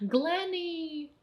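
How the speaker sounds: high, drawn-out sing-song call. Real, not acted. Speaker breaks off to call Glennie, high drawn-out call. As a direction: call the name high and soft, stretched out.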